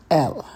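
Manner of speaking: "El" said alone, falling; teacher's careful "El" for repeating; pronunciation demo. Shown as a demonstration. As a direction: model "El" as one clean syllable. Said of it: "That is el".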